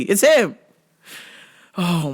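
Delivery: normal talking voice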